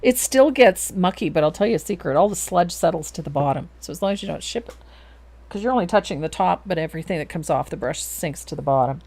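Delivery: normal talking voice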